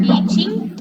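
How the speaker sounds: teaching dicho más alto y fuerte, subiendo al final; emphasised word. Shown as a demonstration. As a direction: resalta teaching en voz alta. (teaching recalcado, agudo y fuerte)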